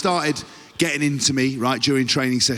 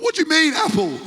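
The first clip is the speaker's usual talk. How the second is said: mock indignation. Player mocked by teammates, protesting in a high indignant voice. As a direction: act stunned at the nickname. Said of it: "I was like".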